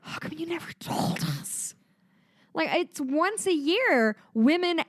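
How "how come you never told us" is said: whispered, higher "How come you never told us?"; whispered mock innocence; mock hushed guys. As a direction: breathe it like a stunned clueless man. Guys answer women, mocking.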